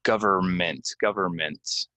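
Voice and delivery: "Government, government" as GUH-ver-ment twice; silent N. Shown as a demonstration. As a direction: leave the first N out of "Government". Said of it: "get the N out of there".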